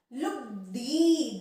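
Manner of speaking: लुगदी spoken slowly with ग joined to लु; drawn-out careful लुगदी; slow demo. Shown as a demonstration. Teacher slowly models लुगदी for the class. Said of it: "इसका उच्चारण देखिए आप ध्यान से".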